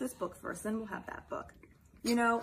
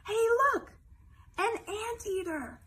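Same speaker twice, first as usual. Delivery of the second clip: excited exclamation; 11 semitones higher, 4 dB louder than usual. Acted.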